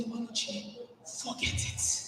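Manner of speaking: soft whispered calming; quiet self-talk. Retold or imitated. Speaker calming herself in the bathroom, whispered. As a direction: whisper to yourself to let go. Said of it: "I breathe and I say".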